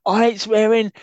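high, loud put-on manager voice; Betis impression. Co-host doing Betis ranting about the club shop. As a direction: rant in character, ranting and fuming. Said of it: "That went a bit steptoe at the end".

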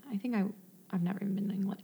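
whispered, under the breath; whispered aside. Real, not acted. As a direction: whisper it as a little confession.

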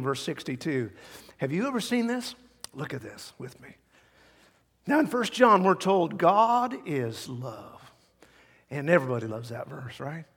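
normal talking voice

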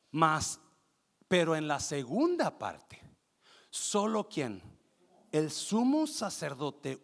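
loud, slow, measured preaching delivery; emphatic scripture reading. Real, not acted. Preacher reads Hebrews nine to the congregation, slow emphatic declaiming. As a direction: read the verse out with drawn-out weight.

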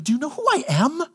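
high, offended, bro-ish angel; hammed-up offended angel; indignant Gabriel. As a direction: do you know who I am, indignantly. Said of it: "Gabe's like".

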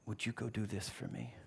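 very soft, low request; hushed, kindly invitation; asking nicely. Acted. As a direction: ask gently, almost under your breath. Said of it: "He has asked us nicely".

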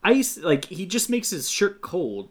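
ordinary delivery

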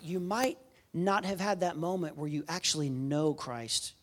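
ordinary delivery

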